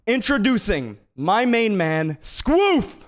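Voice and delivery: announcer-style build to a high, loud name; slow drawn-out intro, punching the name up high; hype introduction. Pretend. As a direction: introduce him like a star entrance. Hyped introduction of his operations manager.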